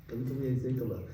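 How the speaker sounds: normal talking voice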